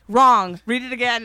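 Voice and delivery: loud, sharp mock correction; retold teacher yelling. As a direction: imitate a strict teacher cutting the child off. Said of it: "why she was yelling at me".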